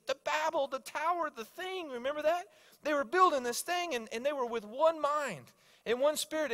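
very high, thrilled retelling; pumped. Retold or imitated. Coworker recalling the tower of Babel, pumped. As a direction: race to share the discovery, voice pitched right up. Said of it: "He was so pumped".

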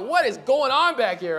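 retold loud bewilderment; exasperated bafflement. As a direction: demand to know what the noise is. Baffled half asleep behind the fridge.

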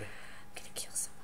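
whispered, muttered threat; seething whispered threat; fuming whisper. Real, not acted. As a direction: breathe it out in quiet fury. Fuming after dying, under breath.

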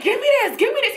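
high, insistent repeated plea; retold urgent demand. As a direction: act out grabbing for it urgently.

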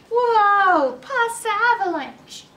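loud, high alarmed whoa; panicked townsperson. Acted. Townspeople fleeing pasta.

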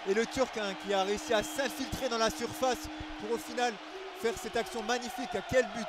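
loud, high praise of the goal; excited sports commentary. Real, not acted. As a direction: gush over a great goal.

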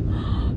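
imitated concerned gasp; sharp mock-shocked intake of breath. Coworkers learning of her pneumonia, shocked gasp.

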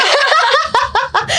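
high, nervous burst of laughter. Real, not acted. Nervous about performing.

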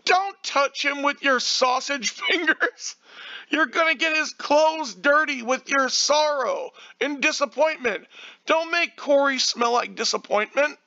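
high-pitched, exclaimed telling-off; mock-frantic scolding. Real, not acted. YouTuber scolding a man for touching Corey, mock-frantic scolding.

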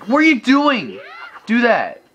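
high-pitched angry yelp at the game; frustrated outburst. Real, not acted. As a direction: burst out high in exasperation. Yelling at the game mid-play.

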